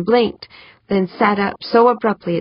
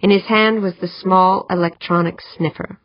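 ordinary delivery

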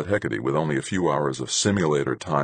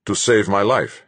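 prompt, emphatic answer, louder and higher; unhesitating; 6 semitones higher, 6 dB louder than usual. A narrator's reading. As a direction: answer firmly without a pause. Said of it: "without an instant's hesitation".

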